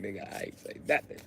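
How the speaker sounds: whispered, looking away; whispered denial. Retold or imitated. Grandson whispering to step-grandpa, innocent whisper.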